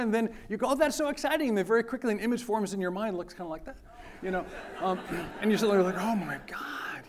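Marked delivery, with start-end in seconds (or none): 0.7-1.5 high, bright mock delight; high-pitched mock excitement; mock delighted student. Student reacting to adults' advice. 6.0-7.0 slow, hushed horror; slow whispered oh my God; whispered dismay. Retold or imitated. Student realizing this is the peak.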